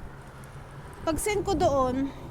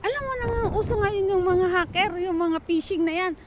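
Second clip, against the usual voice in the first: imitating an exasperated older brother's tirade; brother's angry scolding; 8 semitones higher than usual. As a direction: replay the big brother yelling at you. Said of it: "Sinigaw-sigawan ako sa sobrang galit niya".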